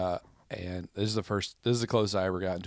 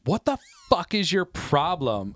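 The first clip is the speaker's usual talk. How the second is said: high, loud, drawn-out shout; mock confrontational screech; retold angry yell; 9 semitones higher, 6 dB louder than usual. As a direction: imitate an outraged girl yelling across the street. Acting angry girl confronting him.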